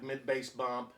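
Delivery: ordinary delivery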